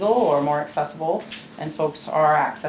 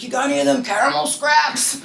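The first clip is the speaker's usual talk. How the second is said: higher-pitched mock mom, hammed up; imitating mom; 10 semitones higher, 4 dB louder than usual. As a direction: put on mom pestering for her treats. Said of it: "now she's like".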